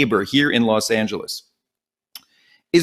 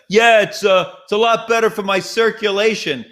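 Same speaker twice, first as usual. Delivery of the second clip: smug; 7 semitones higher than usual. Retold or imitated. Mocking standing desk user.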